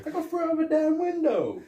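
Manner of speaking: indignant high protest. Retold or imitated. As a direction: squeal it like an offended cop. S1 plays Axel's indignant retort.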